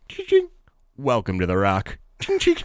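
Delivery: mock intercom announcement with sung dings; ding-ding then a terse welcome; imitating captain. Pilot greets passengers on landing, mock intercom chime. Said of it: "he just goes".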